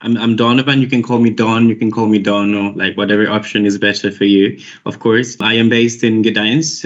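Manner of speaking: usual speaking voice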